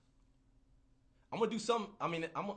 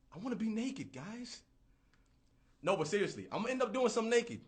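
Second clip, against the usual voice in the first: ordinary talk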